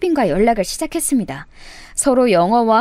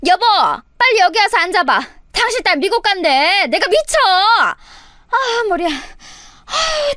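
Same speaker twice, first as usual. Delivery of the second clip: exasperated mother; 8 semitones higher, slower than usual. Acted. Mother exasperated calls husband.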